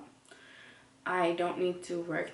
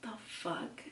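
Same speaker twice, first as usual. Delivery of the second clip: voiceless swear under the breath; muttered aside to camera; whispered disbelief; 2 semitones higher, 12 dB quieter than usual. Real, not acted. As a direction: whisper it in shock.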